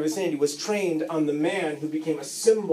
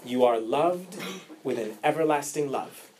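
closing sermon; 4 semitones lower, 5 dB quieter than usual. Acted.

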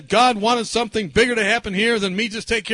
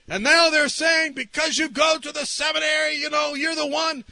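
higher-pitched complaint to God; retold fervent prayer; 6 semitones higher than usual. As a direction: plead under pressure. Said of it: "I was praying that".

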